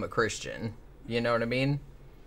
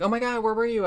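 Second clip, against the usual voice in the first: higher-pitched mock worried mom; 9 semitones higher, faster than usual. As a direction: lightly imitate a fretting mother. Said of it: "like, Smile's like".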